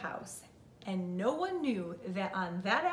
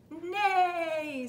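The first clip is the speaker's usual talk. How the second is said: high, loud, long neigh; whinny the word out like a horse; 8 semitones higher, 5 dB louder than usual. A narrator's reading. Horse answering Duck's hello, long loud neigh. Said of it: "said Horse".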